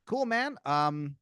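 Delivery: high, loud, casual agreement; pleased corporate caller. Acted. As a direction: agree breezily, then hesitate. Caller happily agrees to handover.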